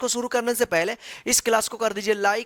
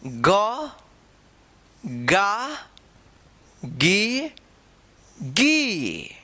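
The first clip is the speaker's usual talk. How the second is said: recitation drill; much slower than usual. Shown as a demonstration. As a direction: drill the syllables one by one for students. Recites barakhadi syllables.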